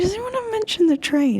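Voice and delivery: high-pitched retold question; acted question. Vlogger retelling a question to the gentleman, high-pitched. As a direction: quote yourself asking, pitched well up. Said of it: "I was like".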